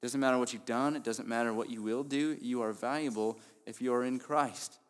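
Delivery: ordinary talk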